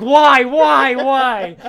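retelling the comic, Warlock cries out at the clowns, mock anguish; mock anguished shout. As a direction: howl it at the sky in despair.

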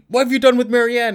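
high, panicked demand to give her back; freaking out. Retold or imitated. Conspiracy theorist panicking over the lost girl, frantic. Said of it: "starts freaking out".

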